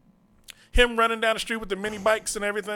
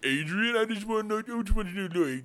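Stallone impression; 3 semitones lower than usual.

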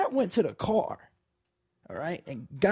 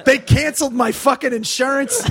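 louder, higher mock outrage; angry comic's punchline; 4 semitones higher, 7 dB louder than usual. Comic quoting Daryl's joke about his car, mock angry. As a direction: deliver the punchline with indignant force. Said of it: "he's very angry".

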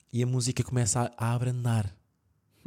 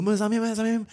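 put-on scared kid; 12 semitones higher, 3 dB louder than usual. Scared child insisting to father.

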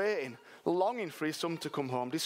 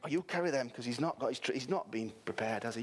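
ordinary delivery

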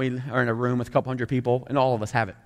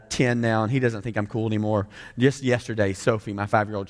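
ordinary talk

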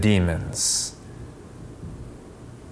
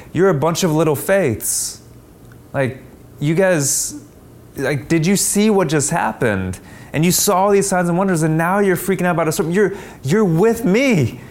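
higher, incredulous scolding, racing then slowing; can you believe this, pitched well up; exasperated Jesus; 8 semitones higher than usual. Retold or imitated. Jesus chides the disciples after the storm, higher and incredulous.

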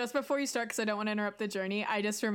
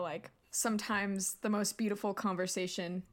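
ordinary talk

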